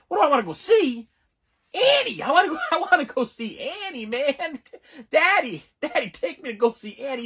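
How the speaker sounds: put-on very high, eager little-boy voice; hammed-up kid begging for a treat; pleading child. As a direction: beg Daddy with squealed excitement. Acting himself as a child begging dad.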